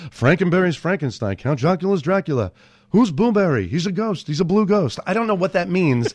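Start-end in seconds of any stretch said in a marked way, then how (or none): 0.1-5.0 bad Jerry Seinfeld. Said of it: "like, a bad, uh, Jerry Seinfeld".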